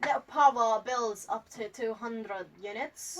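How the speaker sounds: slow, tentative Spanish accent; attempted Spanish accent. Shown as a demonstration. Guest trying Vergara's accent for the host, slow Spanish accent. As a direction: try Sofia Vergara's Spanish accent, carefully drawn out. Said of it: "I'm totally gonna try her accent".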